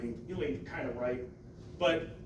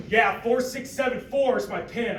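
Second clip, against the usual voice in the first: gruff customer; 7 semitones higher, 7 dB louder than usual. Retold or imitated. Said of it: "he goes".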